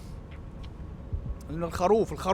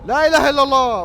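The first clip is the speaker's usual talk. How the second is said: loud, raised cry of fright; alarmed shout; 4 semitones higher, 10 dB louder than usual. Real, not acted. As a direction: shout the prayer, startled. Scared again in the game. (صرخة مخضوضة)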